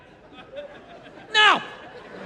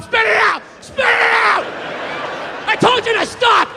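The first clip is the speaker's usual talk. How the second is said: high, loud drill-instructor yelling; bossy shout; 6 semitones higher, 3 dB louder than usual. Acted. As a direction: scold a cheating client at full voice. Acted chef yelling at a dieter.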